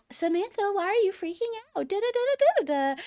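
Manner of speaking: very high mock girly voice; high singsong mimicry trailing into nonsense syllables; girls fussing. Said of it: "the girls are like".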